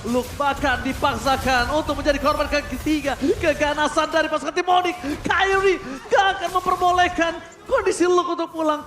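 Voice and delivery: loud, fast play-by-play hype; esports caster. Acted. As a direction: call the teamfight at full energy. Hyped play-by-play of teamfight.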